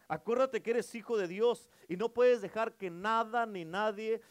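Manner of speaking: loud, rising list driven home; preaching at full force. Acted. Preacher urging church, nobody defines you, full force. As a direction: preach it hard, each item climbing.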